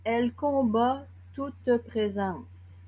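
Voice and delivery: slow, deliberate verse with long pauses; poem recitation. Acted. Poet reciting to the accomplices of violence, measured poem recitation. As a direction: recite it phrase by phrase, weighting key words.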